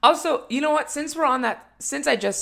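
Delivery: excited aside. Real, not acted. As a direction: jump in eagerly. Sudden high excited aside.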